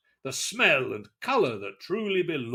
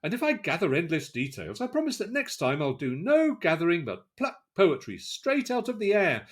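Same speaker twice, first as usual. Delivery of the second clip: ordinary delivery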